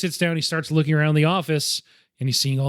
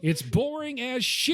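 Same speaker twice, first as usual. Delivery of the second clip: high gruff director; 8 semitones higher, 6 dB quieter, slower than usual. Retold or imitated. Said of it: "quoting Ford".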